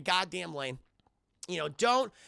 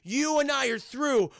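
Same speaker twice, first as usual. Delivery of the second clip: breaking up; 7 semitones higher, 6 dB louder, slower than usual. Retold or imitated. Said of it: "He's like".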